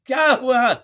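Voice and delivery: ordinary delivery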